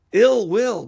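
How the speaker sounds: "ill will" hit with a sudden loud, high jump; stress on ill will. Real, not acted. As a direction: punch "ill will" hard and high, both words loud. Emphatic rant about Putin.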